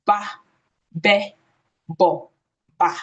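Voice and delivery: "ba, be, bo" with full open vowels after the b; b with a strong vowel added: "ba", "be", "bo"; wrong letter sounds. Shown as a demonstration. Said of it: "don't be the school that goes".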